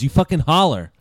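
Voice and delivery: ordinary talk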